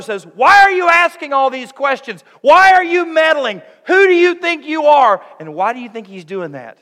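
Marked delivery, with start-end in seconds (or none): 0.3-3.6 much louder and higher, furious scolding; a big brother yelling down at his sibling; angry older brother. Acted. Older brother Eliab rebuking David: furious shout. 3.9-5.2 much higher and louder, each word hurled; angry shout. Acted. Older brother Eliab putting David down: furious shout.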